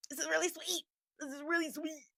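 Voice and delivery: high-pitched retold taste reaction; high little put-on voice; polite reaction. Acts her reaction to sweet drink.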